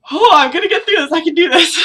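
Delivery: high, loud, hammed-up self-encouragement; mock brave pep talk. Bracing herself for the finale, mock brave pep talk. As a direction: psych yourself up in a squeaky over-the-top voice.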